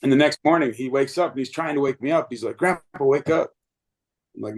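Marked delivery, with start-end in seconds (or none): 2.6-3.5 put-on child's voice. Grandson waking grandpa, voiced child.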